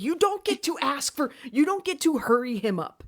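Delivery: high, emphatic telling-off; indignant scolding. Acted. Viewer telling off Gail for hurrying her husband, indignant.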